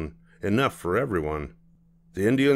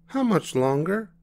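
put-on child voice; 11 semitones higher than usual. Child asks.

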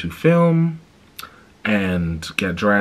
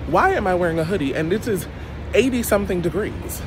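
much higher, fast, exasperated; exasperated repeat; 12 semitones higher, faster than usual. Real, not acted. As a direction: throw the question up in disbelief. Repeats the complaint, more exasperated.

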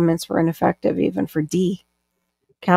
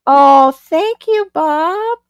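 gushing thanks; 9 semitones higher, 6 dB louder, much slower than usual. Real, not acted. Thanking a viewer on stream.

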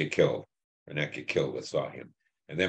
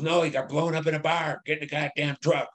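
much higher, strained imitated yell; retold yelling; 12 semitones higher than usual. Sergeant yelling at a green soldier, imitated high yell. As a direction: bark it out like an enraged sergeant, pitched way up. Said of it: "he just yelled and screamed at me".

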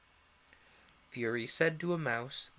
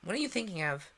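higher-pitched scolding question; severe; 6 semitones higher, 3 dB louder, faster than usual. A narrator's reading. Mouse scolding Alice for drifting off, mildly severe. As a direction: demand it with mild severity, pitched up. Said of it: "said the mouse to Alice severely".

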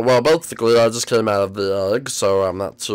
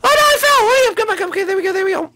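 very high, small character voice; squeaky cartoon voice; faster than usual. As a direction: chirp like a tiny cartoon sidekick.

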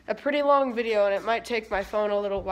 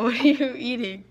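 normal talking voice

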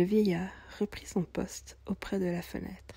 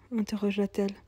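ordinary delivery

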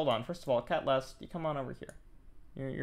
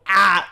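short loud "ah" of stress; exasperated yelp; 4 semitones higher, 9 dB louder than usual. Acted. Streamer venting about a stressful game, exasperated yelp. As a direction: cry out in frustration mid-rant.

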